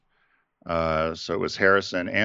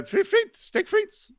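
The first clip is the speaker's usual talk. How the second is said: very high, hammed-up French accent on frites; riffing on French fries, hammed-up French accent; mock French accent; slower than usual. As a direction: squeal steak frites in an overdone French accent. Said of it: "bad, bad accent".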